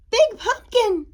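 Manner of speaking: high, loud little-bat voice; excited little storybook bat. A narrator's reading. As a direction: pipe up in amazement. Little bat sees huge pumpkin.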